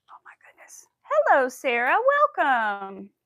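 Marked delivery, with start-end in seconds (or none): 0.1-0.8 whispered shock. Real, not acted. Still shocked.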